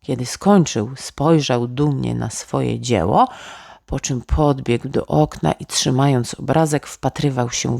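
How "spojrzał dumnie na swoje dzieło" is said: usual speaking voice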